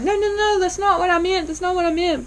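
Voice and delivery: patient hurrying to correct herself, pitched high; hasty correction. Retold or imitated. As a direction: rush to take it back. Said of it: "she's immediately like".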